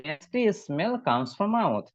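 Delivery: "Musty smell comes from mouth" read out very slowly, word by word; slow model reading. Shown as a demonstration. Teacher models the sentence for students, very slow. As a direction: spell each word out slowly for the class. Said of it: "এইটা দেখে বলতে বলবো".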